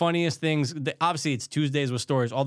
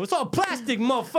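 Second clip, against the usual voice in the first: high, cocky mock robber; hammed-up robber sneer; 10 semitones higher than usual. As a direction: taunt like a smug mugger. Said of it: "They go".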